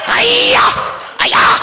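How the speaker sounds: high, loud "ay-ya" war whoop; battle cry. Retold or imitated. Storyteller acting out friend swinging his belt, wild battle cry. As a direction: yell a wild karate-style cry.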